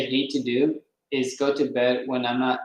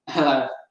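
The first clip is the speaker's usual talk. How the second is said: a brief flirty giggle from the woman on the phone; short coy laugh. Acted. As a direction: laugh it off lightly, a little coy.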